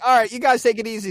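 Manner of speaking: quick, high, loud sign-off; retold parting call. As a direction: toss off a loud hasty goodbye. Retold parting words, raised voice.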